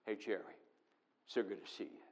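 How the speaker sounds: subdued, joyless welcome; muted greeting. Retold or imitated. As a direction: say it limply, the welcome gone. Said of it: "Oh, no".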